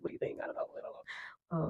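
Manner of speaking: ordinary talk, nothing marked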